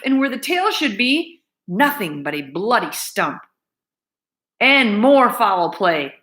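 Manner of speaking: usual speaking voice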